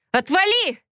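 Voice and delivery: high, sharp scream; woman fighting off her attacker, screaming; shouting. A narrator's reading. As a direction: shove him off with your voice. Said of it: "закричала я".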